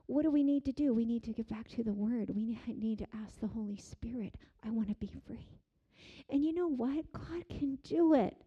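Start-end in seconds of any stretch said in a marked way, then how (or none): 2.3-5.5 whisper. Acted. Whispered plea in sermon.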